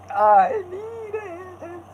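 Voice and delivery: high, slow squeaky line; squeaky cartoon voice.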